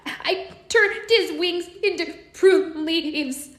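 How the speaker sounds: fairy crying over her punishment. Acted. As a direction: sob through the excuse. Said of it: "the fairy said and cried some more".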